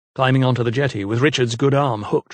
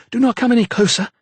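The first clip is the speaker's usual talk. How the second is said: high, urgent plea to keep back; frightened warning; 11 semitones higher, 4 dB louder, faster than usual. A narrator's reading. Mother warning the vicar not to come closer. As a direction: hold him off in fear.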